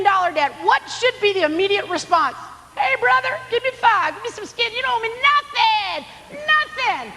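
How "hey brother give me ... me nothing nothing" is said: jovial greeting. Pretend. Ideal generous response acted out.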